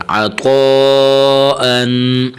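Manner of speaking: long, steady hold on the طو of عطو; stretched madd. Shown as a demonstration. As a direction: stretch عطو out, then close on a held ان. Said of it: "الف کے برابر کھینچنا چاہیے". (عطو کو کھینچ کر پڑھتے ہوئے)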